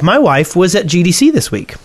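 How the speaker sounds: ordinary talk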